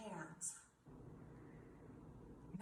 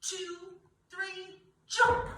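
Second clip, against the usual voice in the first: countdown to jump; 7 semitones higher, much slower than usual. Shown as a demonstration. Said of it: "I want you to jump like a cheetah".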